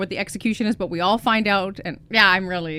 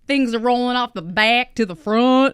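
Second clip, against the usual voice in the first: Texas twang rolling back to front; practising a hammed-up Texas accent; 3 semitones higher, 4 dB louder than usual. Shown as a demonstration. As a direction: imitate a Texan, rolling the words forward. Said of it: "like, if you're into Texas".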